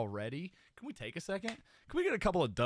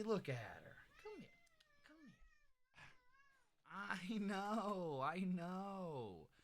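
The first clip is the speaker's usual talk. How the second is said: soft, high cooing; 6 semitones higher, 12 dB quieter than usual. Real, not acted. Owner softly coos to the cat while hugging her. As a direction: coo gently to calm her.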